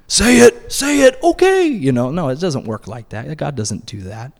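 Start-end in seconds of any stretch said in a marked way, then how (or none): 0.1-1.7 mock bully taunt. Mock arm-twisting bully.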